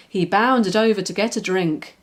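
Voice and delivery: ordinary delivery, nothing marked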